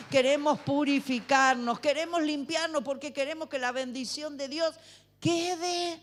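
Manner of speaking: rousing call to the congregation; fervent preaching. Acted. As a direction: preach it out to the standing crowd. Preaches at full force.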